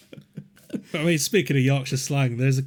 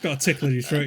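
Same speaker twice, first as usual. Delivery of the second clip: usual speaking voice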